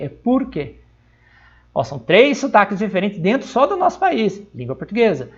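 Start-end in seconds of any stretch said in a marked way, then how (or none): 0.1-0.8 "por quê" with the R of São Paulo city; São Paulo capital R. Shown as a demonstration. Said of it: "Eles falam assim".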